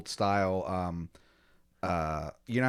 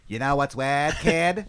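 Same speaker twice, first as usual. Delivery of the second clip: higher, louder bad Boston accent; awful Boston accent; 4 semitones higher, 5 dB louder, slower than usual. Colonist addressing the newcomer as kid, awful Boston accent. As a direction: broaden WEIRD into fake Bostonese. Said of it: "he says in that awful accent".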